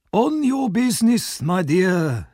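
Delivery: slow, high oily voice. Acted. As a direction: slide past the question.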